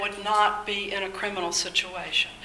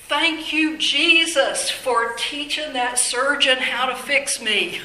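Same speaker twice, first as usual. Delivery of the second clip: raised, louder cry of gratitude; fervent thanks; 5 semitones higher, 4 dB louder than usual. Real, not acted. Back patient thanking Jesus for the surgeon, fervent thanks. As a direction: exclaim it high and heartfelt, like praise.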